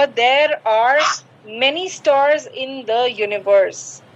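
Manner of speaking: very slow, stars stressed; slow corrected sentence. Shown as a demonstration. Teacher giving the correct version, slow and clear. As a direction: read it out word by word. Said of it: "तो वो होगा".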